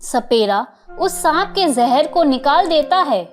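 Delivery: usual speaking voice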